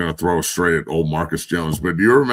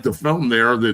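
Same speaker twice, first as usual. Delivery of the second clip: ordinary delivery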